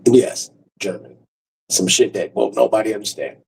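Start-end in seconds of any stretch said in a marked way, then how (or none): none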